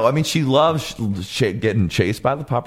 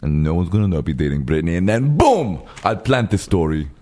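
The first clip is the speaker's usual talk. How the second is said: [name]; 4 semitones lower than usual. Acted.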